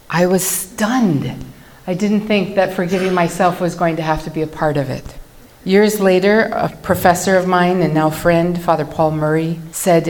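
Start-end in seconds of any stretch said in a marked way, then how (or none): none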